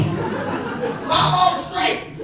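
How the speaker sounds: high, slow, breathy laugh on the words. Real, not acted. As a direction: laugh your way through it. Recalling being very high.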